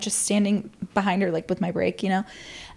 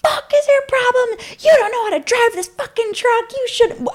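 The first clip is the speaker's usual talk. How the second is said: yelling at the friend who backed her truck onto the car, furious and shrill; going off; 14 semitones higher than usual. Retold or imitated. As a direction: scream at her like she wrecked your car. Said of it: "I'm, like, going off".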